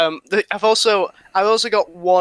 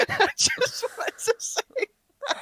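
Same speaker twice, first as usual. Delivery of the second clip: higher, breathy giggle through the words; laughing through words; 6 dB quieter than usual. Real, not acted. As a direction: squeeze it out while cracking up. Giggling after the joke.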